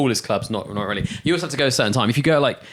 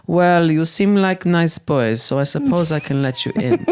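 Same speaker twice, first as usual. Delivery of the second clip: put-on drawn-out, higher, grudging door-girl voice; reluctant door girl; 8 semitones higher than usual. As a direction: imitate her slowly, grudgingly letting them in. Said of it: "And she's like".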